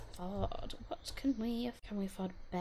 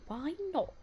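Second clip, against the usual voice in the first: put-on posh accent; 6 semitones higher than usual. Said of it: "I sounded really posh then".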